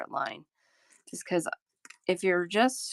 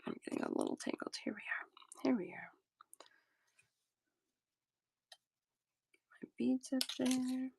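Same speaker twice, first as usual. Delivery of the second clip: whispering; 7 semitones higher, 8 dB quieter than usual. Real, not acted. Tangled in wire, muttering to herself.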